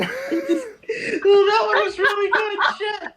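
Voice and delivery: trying to talk while still cracking up; laughing while talking. Real, not acted. Friend praising the cow joke while still laughing hard.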